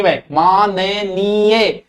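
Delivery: माननीय stretched very slowly, each syllable clear; careful demo. Shown as a demonstration. Teacher models माननीय for students, very slow. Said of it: "माननीय को नहीं".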